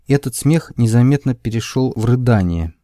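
ordinary delivery

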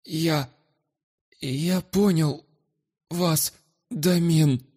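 high, very slow, frightened wheeze. Acted. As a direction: wheeze it out in fear. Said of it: "просипел".

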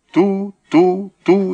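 high, even hooting phone beeps; imitated dial tone. A narrator's reading. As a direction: imitate the beeps of a dead line. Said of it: "запела трубка". (высокие гудки телефонной трубки)

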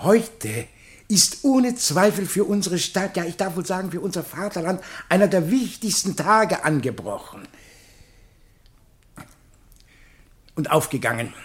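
ceremonious address with long pauses between the titles; pompous public speech; formal speech. Acted. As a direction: address the assembled society solemnly, like a festive orator.

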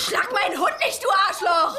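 outraged cry at the man; angry yell. Acted. Dulcie defends her dog.

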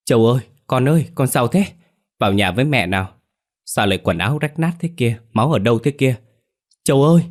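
crying out in nightmare. A narrator's reading. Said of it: "bà Tảo ú ớ gào lên". (gào gọi con hoảng hốt trong cơn ác mộng)

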